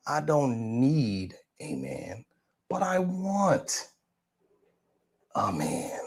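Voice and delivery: quoting her again, now soft and slow; soft slow quote. Retold or imitated. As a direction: hush it down, drawing each word out. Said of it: "is a woman saying".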